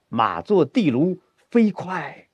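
slow build, high lift on 飞快; galloping recitation demo. Shown as a demonstration. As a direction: set the horse running for the student. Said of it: "哎，跑一会儿". (示范朗诵，读出奔马的气势)

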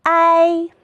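"I" as long āi, high and level; first-tone "I", drawn out for learners; tone drill. Shown as a demonstration. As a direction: hold "I" on one high flat note, first tone. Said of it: "和老师一起比划着读一读吧".

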